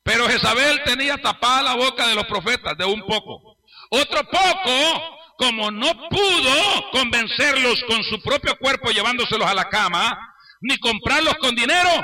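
impassioned preacher delivery; forceful preaching. Real, not acted. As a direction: hammer out the sermon, drawing out key words.

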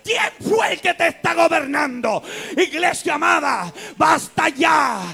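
loud, high shout slowing to a stop; fiery preaching. Acted. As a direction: rebuke the church at full force. Rebuking church, crescendo.

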